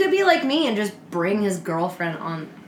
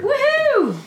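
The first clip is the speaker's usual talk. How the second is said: cheering; 5 dB louder than usual. Real, not acted. Cheering season two.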